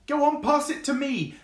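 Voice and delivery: very high and eager, bouncing with excitement; excited fox. Acted. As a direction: beg to play, squeaky with glee. Excited fox begging to play.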